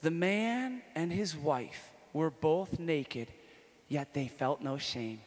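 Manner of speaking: slow, deliberate reading of felt no shame; slow scripture reading. Shown as a demonstration. Reading Genesis to the audience, slow and deliberate. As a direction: read it slowly, weighting felt. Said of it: "listen to this".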